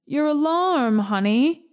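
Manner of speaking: high-pitched calling on the first words; mild yell. A narrator's reading. Mama to her child from the kitchen, mild yell. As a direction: sing out a light reminder from afar. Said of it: "yells Mama from the kitchen".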